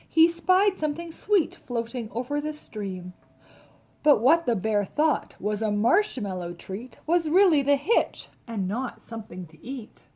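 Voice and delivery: ordinary delivery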